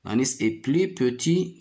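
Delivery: usual speaking voice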